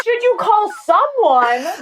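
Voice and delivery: high, slow exasperated echo; incredulous echo. Retold or imitated. Exasperated reply to friend during emergency, high and slow. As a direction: throw her words back at her. Incredulous retort mid-Heimlich.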